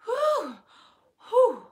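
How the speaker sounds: high loud relieved sighs; relieved whew. Retold or imitated. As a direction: blow out big relief. Retold relief.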